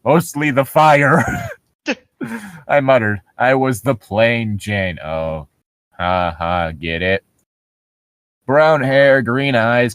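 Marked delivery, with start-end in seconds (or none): none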